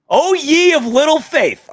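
high, loud, slow preaching; mock preacher. Host chides his doubting co-fan like a booming preacher. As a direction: boom it like a pulpit rebuke.

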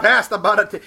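high, loud, hammed-up Boston accent; mock Boston accent. Friend echoing the Costner parody, loud fake Boston accent. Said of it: "roles that require an accent".

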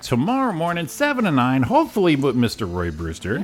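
high, loud, upbeat radio outro; lifted, projected announcer wrap-up; radio host sign-off. Acted. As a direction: sign off the show in a big host voice.

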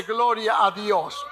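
normal talking voice

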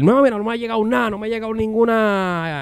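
mock-agitated neighbor ranting high; yelling neighbor. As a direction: play the neighbor insisting it was fake. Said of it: "empieza a gritar".

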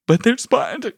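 put-on high, whining protest from a frightened kid; mock whine of a child afraid of spiders; whiny scared child. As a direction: whimper it like a scared little girl. Daughter whining about the spider.

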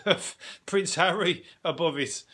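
ordinary delivery

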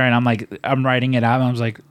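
ordinary talk, nothing marked

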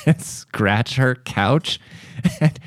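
high, giggling through the story; can barely get it out for laughing; laughing through words. Real, not acted. Telling a friend about the cat, laughing hard.

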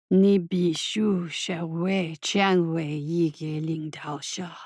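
low, very slow and heavy. A narrator's reading. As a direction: let the words sink like stones. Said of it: "continued the heavy voice".

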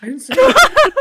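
high, loud, panicked denial; panicked squeak. Acted. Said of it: "Karina denying, panicked".

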